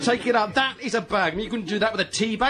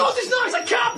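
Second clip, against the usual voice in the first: much higher exasperated protest; exasperated outburst. Real, not acted. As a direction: lose patience in the argument. Exasperated argument over cup.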